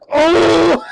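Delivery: high, loud drawn-out "oh"; big exaggerated yelled reaction; mock shocked reaction. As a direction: imitate a crowd's belated outcry, very high and loud. Mimics chat's delayed reaction.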